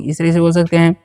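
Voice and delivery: ordinary talk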